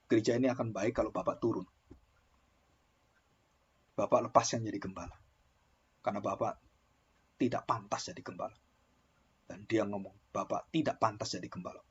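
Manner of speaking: church member. Acted. A church member quietly tells the pastor to resign. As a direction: tell the pastor to step down, quietly.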